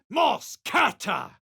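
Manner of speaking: high, slow shouted incantation; shouted spell. Acted. Adventurer casting a spell, slow high shout. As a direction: bellow the spell slowly.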